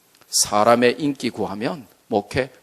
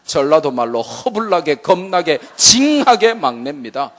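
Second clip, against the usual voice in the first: usual speaking voice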